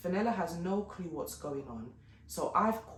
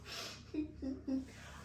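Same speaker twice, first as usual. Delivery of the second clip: unvoiced laugh; 9 semitones higher, 8 dB quieter than usual. Real, not acted.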